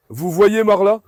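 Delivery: ordinary talk, nothing marked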